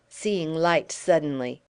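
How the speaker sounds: plain reading voice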